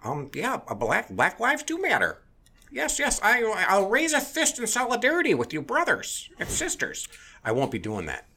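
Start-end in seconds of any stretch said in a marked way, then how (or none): 0.0-7.1 much higher, sugary sarcastic compliance; sarcastic higher-pitched solidarity act; mock fawning agreement. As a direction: parody a meek diner eagerly agreeing. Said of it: "I'm not gonna just sit there and go".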